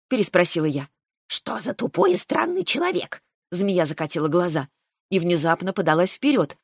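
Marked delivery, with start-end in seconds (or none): 1.3-3.2 scornful snake. Acted.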